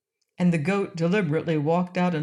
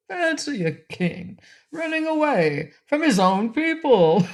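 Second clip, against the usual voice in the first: laughing; 9 semitones higher than usual. Acted. Jolly king laughing at himself.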